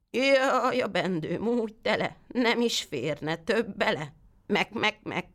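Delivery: a sly goat bleating its rhyme, pitched high. Acted. The goat fibs to the boy in a bleating goat voice. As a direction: stretch the rhyme slowly and end on a bleat.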